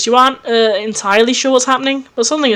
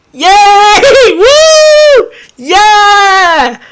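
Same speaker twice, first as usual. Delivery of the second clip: very high, loud cheering shouts; celebrating the episode's end; 11 semitones higher, 9 dB louder, much slower than usual. Real, not acted. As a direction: whoop with joy. Cheering at the ending.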